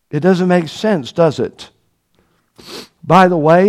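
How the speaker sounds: normal talking voice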